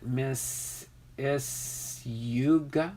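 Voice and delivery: slow "Miss ... Isuga?" with stretched YOU sound; "Isuga" sounded out slowly, rising at the end; alternative pronunciation. Shown as a demonstration. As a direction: try "Miss Isuga" as a drawn-out question. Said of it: "Or is it".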